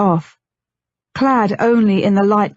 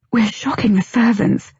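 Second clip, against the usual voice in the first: usual speaking voice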